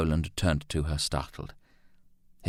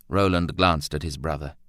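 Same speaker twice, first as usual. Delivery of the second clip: ordinary delivery, nothing marked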